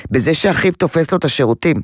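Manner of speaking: ordinary delivery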